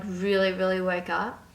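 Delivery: ordinary delivery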